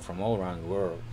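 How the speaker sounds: normal talking voice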